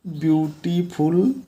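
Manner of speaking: beautiful stretched out very slowly for the class; teacher reading beautiful slowly for students to copy; slow model reading. Shown as a demonstration. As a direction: read beautiful at dictation pace. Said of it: "यहाँ पे more का प्रयोग हो जाएगा".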